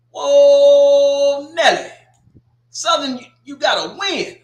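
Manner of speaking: high, loud "Whoa, Nelly" shout; loud, high, playful disbelief; excited shout. Real, not acted. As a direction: yell it like you can't believe they won. Shocked cheer at a Southern win.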